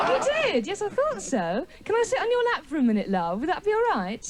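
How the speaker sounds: high, sing-song patronising chat; mock cooing. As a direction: humour him like a small child. Talking down to audience man.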